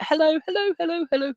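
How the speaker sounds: high, chirpy repeated hellos; sister calling hello. Retold or imitated. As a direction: chirp it like a row of robins. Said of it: "just to let you know that".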